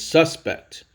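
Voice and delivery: suspect said SUS-pect, the noun; stress on first syllable. Shown as a demonstration. Teacher introducing the noun version of suspect, stressed on the first syllable. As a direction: punch SUS in suspect and let pect follow lighter. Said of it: "The accent is stressed on the first syllable".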